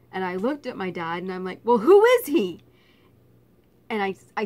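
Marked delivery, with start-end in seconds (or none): 1.6-2.6 indignant. Retold or imitated. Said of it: "I was still so mad".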